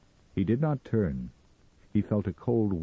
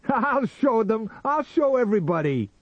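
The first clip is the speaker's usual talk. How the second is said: manic triumph; 7 dB louder than usual. A narrator's reading. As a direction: shout it wildly to the world. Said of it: "He laughed and fired again".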